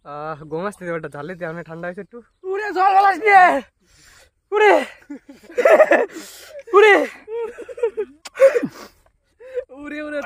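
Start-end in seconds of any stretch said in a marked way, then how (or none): 2.5-10.2 pained spicy gasps. Real, not acted. Gasping from spicy heat.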